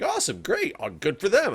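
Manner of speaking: high-pitched mock cheer; mock cheerful. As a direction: squeal it like happy news on the radio. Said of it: "I was like".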